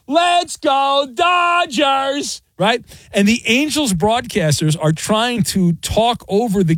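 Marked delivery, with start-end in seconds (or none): 0.1-2.4 put-on high, slow sing-song chant of a small boy; cute little kid voice. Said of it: "with his cute little kid voice".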